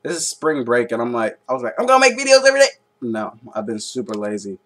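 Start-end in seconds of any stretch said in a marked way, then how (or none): 1.8-2.7 high, loud, gung-ho boast; mock enthusiasm. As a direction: imitate your over-keen past self. Mocking own past enthusiasm.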